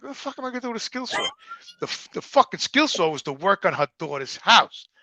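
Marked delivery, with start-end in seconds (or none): 0.0-1.3 fast, high disbelieving outburst; incredulous. Retold or imitated. Man opening a skill saw gift, fast incredulous. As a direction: rattle it off in disbelief.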